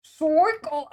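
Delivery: loud, high New York "Circle" as "sɔkəl"; a big New York "Circle", almost called out; New York accent. Shown as a demonstration. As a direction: nail "Circle" like a New Yorker. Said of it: "you're actually right".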